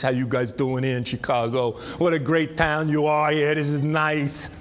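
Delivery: lightly put-on New Jersey Turnpike accent, same pitch; easy Sopranos-country New Jersey accent. Shown as a demonstration. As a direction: slide into the Jersey accent you grew up with. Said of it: "I grew up talking like this".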